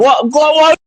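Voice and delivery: high shouted outburst; angry yell. Real, not acted. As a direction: fire it back, furious and high. Caller challenges host angrily.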